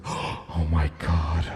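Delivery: slow, low gasped horror. Acted. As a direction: gasp it out slowly, hammed-up dread.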